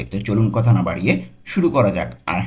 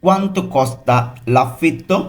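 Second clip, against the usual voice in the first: slow, careful Italian for learners; very slow model reading; 4 semitones higher, much slower than usual. Shown as a demonstration. Teacher models the phrase slowly for students. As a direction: spell it out slowly for the class. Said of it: "এর Italian কী হবে?".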